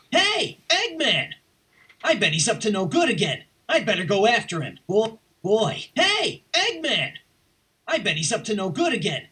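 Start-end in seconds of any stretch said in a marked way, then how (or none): 0.1-1.3 calling out. Acted. Calling out to Eggman. 5.9-7.1 calling out. Acted. Calling out to Eggman, retake.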